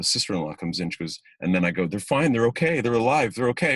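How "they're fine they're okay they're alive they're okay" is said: raised, loud, insistent reassurance; desperate relief. Retold or imitated. Father telling the sister-in-law they're alive, overwhelmed. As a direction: re-enact it bursting out with relief. Acting tearful relief in audition scene.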